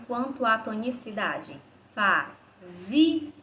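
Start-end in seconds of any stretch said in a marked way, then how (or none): 1.9-3.3 "fazia" split up, with ZI punched louder; syllable-by-syllable "fazia", strongest on ZI; stress on ZI. Shown as a demonstration. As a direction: say "fazia" as fa, ZI, a, leaning hard on the middle syllable. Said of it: "a nossa sílaba mais forte é a penúltima".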